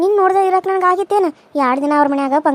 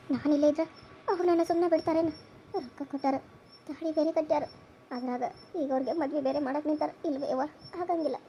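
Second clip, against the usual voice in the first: hushed; 13 dB quieter than usual. Acted. Daughter confides fear to mother.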